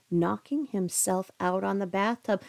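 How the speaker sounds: ordinary delivery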